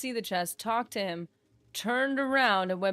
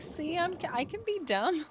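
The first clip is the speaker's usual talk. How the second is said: high playful voice; 10 semitones higher than usual. Retold or imitated. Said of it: "I was like".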